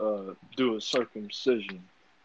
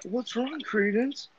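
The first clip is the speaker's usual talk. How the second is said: mock aunt; 13 semitones higher, slower than usual. Friend replays the aunt questioning her child, high mock voice. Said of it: "she looks at her and she's like".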